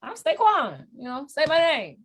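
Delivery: high, proud swagger voice; high mock boast. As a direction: puff up like a confident kid.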